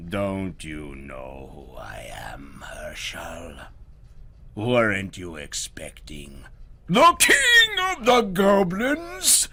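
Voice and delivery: Goblin King roar. Acted. Goblin King reveals himself to Herschel, rising to a roar. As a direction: start menacing, end roaring. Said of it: "rose to a hurricane roar".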